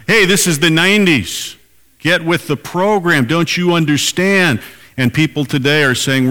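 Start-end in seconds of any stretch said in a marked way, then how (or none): 0.1-4.6 much higher, insistent scoffing voice; impatient critics. Retold or imitated. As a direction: needle him like an impatient know-it-all. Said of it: "in the nineties".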